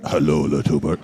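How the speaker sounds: a low, darker take of Hello, little bird; Hello, little bird dropped low and rough; low, gruff line. Retold or imitated. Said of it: "And you're going".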